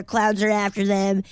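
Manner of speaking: ordinary delivery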